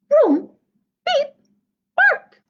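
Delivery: loud vroom, beep, squeaky high bark; truck puppy noises. Acted. Reader voicing the returning puppy truck loudly. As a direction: rev up then yip like a puppy.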